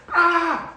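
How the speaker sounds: high, loud startled "Ah!"; scream. Retold or imitated. Startled by a big rat, a loud high scream. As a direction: yelp in fright at the rat. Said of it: "I screamed".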